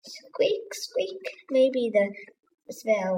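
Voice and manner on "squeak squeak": high, breathy mouse squeaks; two little whispered squeaks. A narrator's reading.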